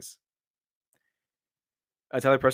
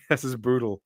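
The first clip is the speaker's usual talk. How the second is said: laughing. Real, not acted. Laughing at a dark joke.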